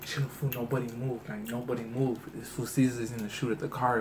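low, very soft urgent caution; hushed warning. Retold or imitated. Homie warning the car about the gunman, hushed and low. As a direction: keep it down so nobody hears.

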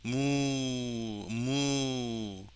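long, drawn-out hummed moo; cow moo imitation. Shown as a demonstration. Teacher recording cow samples for the computer, imitated mooing. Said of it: "소".

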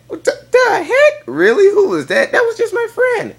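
hammed-up high-pitched "who was that" defence; mock indignation. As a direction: squeal it like she's being ridiculous. Indignant reply to jealous girlfriend.

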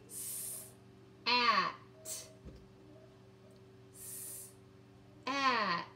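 "S-" hissed apart, then "at"; sounding out. Shown as a demonstration. Teacher sounds out "S-" "at" for kids, slowly separated. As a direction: split "S-" from "at" with a pause between.

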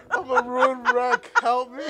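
high, slow mock wail through laughter; mock despair. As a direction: wail like a ruined wreck, laughing. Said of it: "I'm like".

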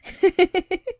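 high quick laugh; happy laugh. Real, not acted. After announcing movie night, laughing. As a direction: laugh at own reveal.

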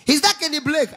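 high-pitched imitated phone caller; mock a poor man calling up, pitched high; high put-on caller voice; caller from Aba. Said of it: "poor man wey coming from Aba".

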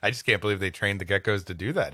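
normal talking voice

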